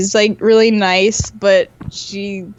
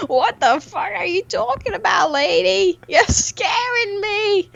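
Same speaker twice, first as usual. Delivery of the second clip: high mock panic, slow at the end; mock scared; 9 semitones higher than usual. Retelling her inner panic at a customer, mock scared. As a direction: squeal it like a frightened mock victim.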